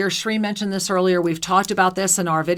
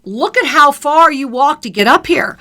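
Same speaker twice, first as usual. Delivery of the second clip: raised, louder and higher exclamation; exclaiming; 4 semitones higher, 7 dB louder than usual. Acted. Voicing the full moon atop the temple, exclaiming. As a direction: call it out as if from the top. Voices the temple message in awe.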